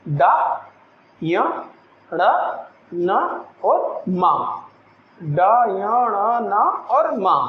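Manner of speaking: डा, य, ण, न, मा slow and nasal; nasal consonant demo. Shown as a demonstration. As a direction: hum out न and मा through the nose slowly. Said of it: "आप इसको एक बार उच्चारण करके भी देख". (नासिक्य व्यंजनों का धीमा उच्चारण)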